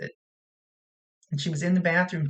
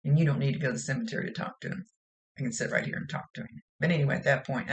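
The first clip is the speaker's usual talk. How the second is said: ordinary talk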